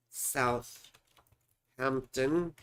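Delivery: slow, drawn-out dictation with a rise; spelling it out aloud slowly to the software; drawn out, dictating. Real, not acted. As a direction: stretch the name very slowly while typing. Retyping city into search.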